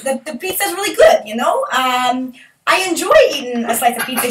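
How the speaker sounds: put-on broad New Jersey accent answering about pizza. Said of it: "easy things to say in that accent".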